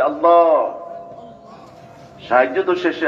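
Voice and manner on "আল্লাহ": long, raised call imitating the woman's plea; retold cry to Allah. Preacher retelling the mother's appeal for help, imitated cry out. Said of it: "আর যখন মহিলা বলছে".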